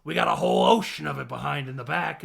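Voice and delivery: a loud mock Penguin boast. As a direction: bark it out like DeVito's Penguin. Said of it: "and he's like".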